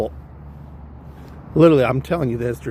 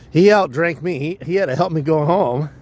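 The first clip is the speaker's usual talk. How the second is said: ordinary delivery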